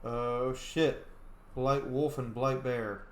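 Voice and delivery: ordinary talk, nothing marked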